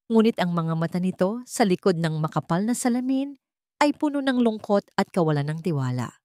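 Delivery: ordinary delivery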